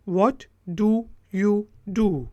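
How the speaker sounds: slow, stretched question; slow demo. Shown as a demonstration. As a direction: say it slowly for learners. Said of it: "आप क्या करते हैं?". (धीमा सवाल)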